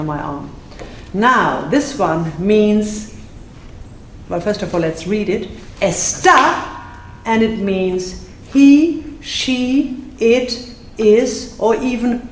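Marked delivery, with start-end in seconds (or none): 5.8-6.6 "Está" with the last syllable punched high and louder; stamped stress. Shown as a demonstration. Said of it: "that's why I do all this stamping".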